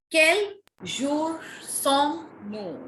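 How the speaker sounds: slow, separated QUEL JOUR SOMMES-NOUS, QUEL said "kell"; model pronunciation. Shown as a demonstration. Teacher modelling the chapter name for students, slow QUEL as "kell". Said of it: "is care".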